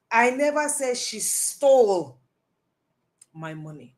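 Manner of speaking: "stole" held long, pause, hushed ending; stress on STOLE. Shown as a demonstration. Coach listing alternatives to stealing, stress on "stole". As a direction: hold "stole" and let the rest fall away. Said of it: "She may have taken it".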